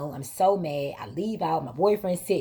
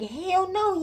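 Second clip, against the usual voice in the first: indignant; 13 semitones higher than usual. Retold or imitated. Outraged reply to sister.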